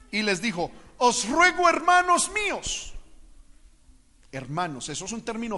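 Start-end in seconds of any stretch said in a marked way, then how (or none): 1.0-2.9 high, drawn-out scripture pleading; declaimed plea. Acted. Lot begging the men at his door, declaimed plea. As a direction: plead slowly in a raised reading voice.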